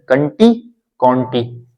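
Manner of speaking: कंटी then कॉन्टी drawn out slowly; slow careful contrast of कंटी and कॉन्टी; slow contrast demo. Shown as a demonstration. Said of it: "कंटी कॉन्टी में ध्यान रखना है".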